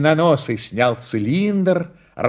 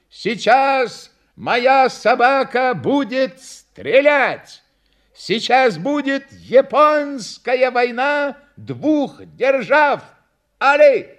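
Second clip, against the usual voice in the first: high, slow, grand showman; circus announcer; 10 semitones higher, 4 dB louder, much slower than usual. A narrator's reading. Clown announces the shooting trick, grand ringmaster. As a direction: proclaim it word by word, ending loud.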